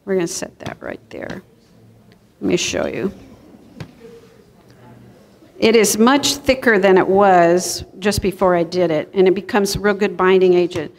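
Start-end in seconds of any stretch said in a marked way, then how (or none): none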